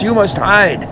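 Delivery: hurried, urgent hushed warning. Acted. Giant's wife warning Jack, urgently. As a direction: hurry him into hiding. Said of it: "the woman says".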